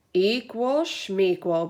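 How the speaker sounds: mumbled through a mouthful; mouth full. Acted. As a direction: mumble around a bite of cake. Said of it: "with a mouthful of chocolate cake".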